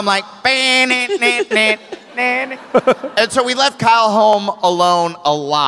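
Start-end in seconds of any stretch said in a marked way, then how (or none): 0.4-2.1 sung nonsense riff; imitated instrument riff. Acting out playing alone at home, vocal riff. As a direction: mouth a guitar riff.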